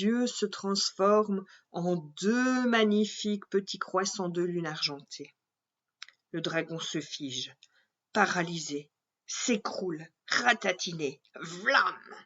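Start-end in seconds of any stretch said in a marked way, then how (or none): none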